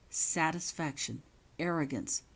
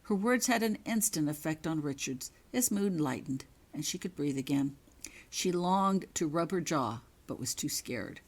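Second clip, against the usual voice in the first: ordinary delivery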